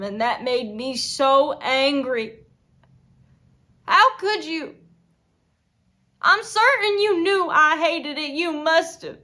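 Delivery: anger building to loud, high outburst; furious accusation. Acted. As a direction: hurl the accusation at the husband, loudly. Accusing husband of cruelty.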